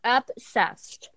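talking with a mouthful; words around a bite of food; wolfing down food. Real, not acted. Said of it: "What are you eating now?".

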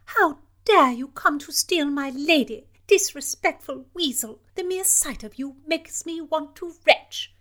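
high, loud scornful berating; shower him with contempt; furious princess. Acted.

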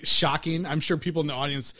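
normal talking voice

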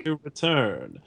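a hint of a solemn narrator voice; bad Morgan Freeman. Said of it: "a bad Morgan Freeman impression".

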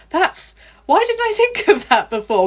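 re-enacted eureka moment; excited realisation. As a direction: exclaim it like the penny has just dropped. Retells own sudden realisation.

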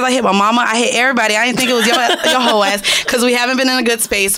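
normal talking voice

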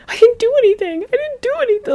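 very high, hammed-up squeal; mock protesting innocence. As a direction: imitate her protesting in a squeaky voice. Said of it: "you can hear in her voice".

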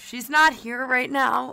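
answering machine voice. Pretend. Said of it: "Can I take a message for her?".

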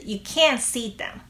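can't punched, t nearly dropped; natural You can't see them with the weight on can't; stress on can't. Shown as a demonstration. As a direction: hit can't, let the t fade. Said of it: "再听一次".

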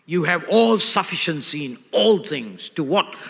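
normal talking voice

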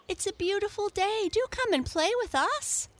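high, loud, eager invitation; coaxing cry. A narrator's reading. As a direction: beg him to come play, high and loud. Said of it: "cried the Merry Little Breeze".

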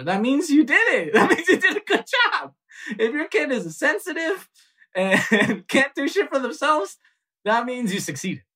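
high, laughing mock praise; telling the host about immigrant parenting, high and laughing; laughing irony. Real, not acted. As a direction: laugh through the ironic punchline. Ironic joke about immigrant parents.

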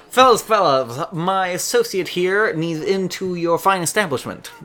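high, loud smooth-talker; loud, high wheedling little gent; small man's voice. Acted. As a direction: charm the bouncers like a tiny con man. GM voices small man NPC.

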